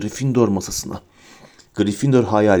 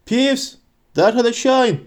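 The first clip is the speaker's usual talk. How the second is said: much higher, contained angry order; angry shout; 10 semitones higher than usual. A narrator's reading. Professor orders Peeves to come down, angry high-pitched shout. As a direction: order him down in a high, strained call. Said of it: "diye gürledi kızgın bir ses".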